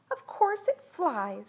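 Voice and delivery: put-on high, assured little-girl voice; boastful girl. A narrator's reading. Girl boasts about her rocket.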